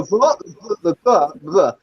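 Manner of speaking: ordinary delivery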